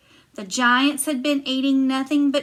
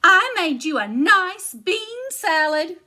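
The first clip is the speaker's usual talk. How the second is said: high, louder huffy giantess; 7 semitones higher, 4 dB louder than usual. Acted. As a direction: brush it off, pitched up.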